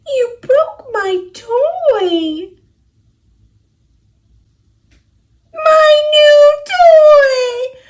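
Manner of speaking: high, loud, slow sob over the broken toy; crying out high and loud; wailing cry. Acted. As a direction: wail it like a heartbroken child.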